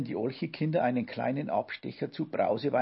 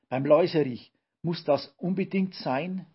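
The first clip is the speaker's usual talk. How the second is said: higher, louder dismayed protest; shocked cry; 5 semitones higher, 6 dB louder than usual. A narrator's reading. Olchi kids protest the cleaning product, shocked and loud. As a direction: plead in horror against cleaning. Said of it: "riefen die Olche-Kinder erschrocken".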